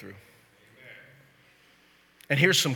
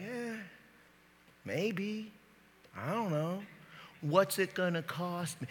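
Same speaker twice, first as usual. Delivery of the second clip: higher, softer, hesitant waffle; noncommittal; 4 semitones higher, 7 dB quieter, slower than usual. Acted. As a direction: play the reluctant doubter, pitched up and quiet. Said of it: "you're like".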